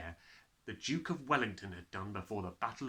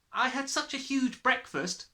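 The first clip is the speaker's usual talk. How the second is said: put-on much higher, louder little girl voice; a schoolgirl making an excuse, pitched high; Lavender's voice; 14 semitones higher, 5 dB louder than usual. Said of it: "Lavender said".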